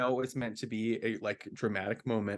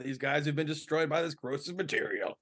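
put-on McCoy accent; 3 semitones higher than usual. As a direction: toss in a hint of McCoy's accent. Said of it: "that was my McCoy accent".

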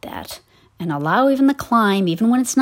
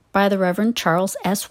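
ordinary talk

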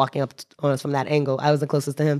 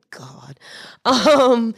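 usual speaking voice

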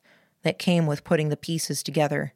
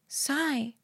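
soft, high, almost afraid voice; hushed, higher woman's voice; afraid whisper; 9 semitones higher, 7 dB quieter than usual. A narrator's reading. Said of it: "she whispered".

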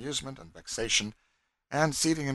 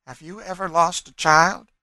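high, loud, pointed question; sudden question; 9 semitones higher, 11 dB louder than usual. A narrator's reading. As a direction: ask it abruptly in a raised pitch. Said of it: "she said suddenly".

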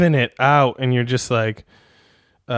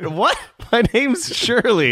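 confused partner; 9 semitones higher, slower than usual. Acted. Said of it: "partner's reply".

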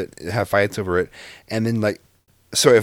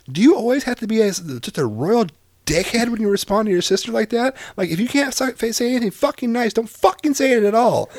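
higher, fast scolding rant; retold angry rant; 13 semitones higher, 3 dB louder than usual. Dad scolding son in the store, retold angry rant. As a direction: chew out the son, voice up high. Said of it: "and I'm like".

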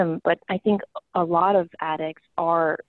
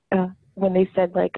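usual speaking voice

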